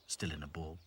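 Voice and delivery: plain reading voice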